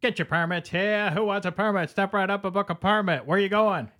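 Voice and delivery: high, hawking the wares; carnival barker. Acted. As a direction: call out like a fairground barker. Hawking permits like vendor.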